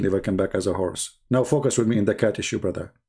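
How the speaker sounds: ordinary talk, nothing marked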